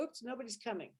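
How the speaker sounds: hushed, sinking let-down; quietly giving up on being heard; deflated. Acted. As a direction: drop to a small, soft voice. Acts out a cry going unanswered.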